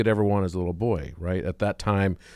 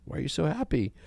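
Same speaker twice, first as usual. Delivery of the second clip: higher, softer puzzled question; baffled psychiatrist; 8 semitones higher, 5 dB quieter than usual. Acted. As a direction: ask in a lightly put-on bewildered voice. Psychiatrist asks optimist boy.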